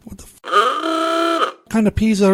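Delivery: high, drawn-out cry of disbelief; can't believe what he just heard; shocked disbelief. Real, not acted. Reacting to peas tasting like blood.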